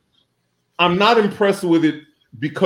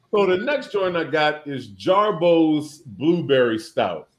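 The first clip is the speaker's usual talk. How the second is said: usual speaking voice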